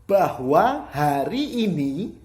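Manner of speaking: "Bahwa hari ini" very slow, high and loud; strong emphasis. Shown as a demonstration. Teacher gives example of emphasis, "Bahwa hari ini" loud and drawn out. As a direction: stretch and push each word of "Bahwa hari ini". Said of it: "itu contoh penekanan".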